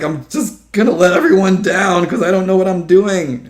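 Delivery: loud, high panicky venting; retold frantic complaint, high and loud; stressed-out outburst. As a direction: wail it in a worked-up voice. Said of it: "I come home all stressed out".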